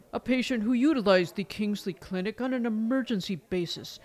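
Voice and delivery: normal talking voice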